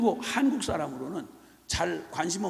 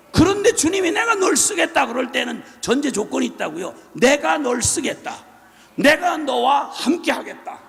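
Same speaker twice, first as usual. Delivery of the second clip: loud, rising sermon cry; fervent preaching; 5 semitones higher, 8 dB louder than usual. Acted. Preacher proclaiming God's promise to the congregation, loud fervent preaching. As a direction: proclaim it to the whole church.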